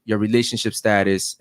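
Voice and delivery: normal talking voice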